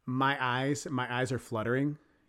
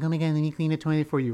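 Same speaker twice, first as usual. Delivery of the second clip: lightly mimicked, rushed offer of help; hurried, mildly mocking imitation; fussing woman; 4 semitones higher, 6 dB louder, faster than usual. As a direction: rattle it off as the fussing woman, a bit higher and louder. Voicing the woman in his story.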